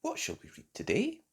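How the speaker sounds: usual speaking voice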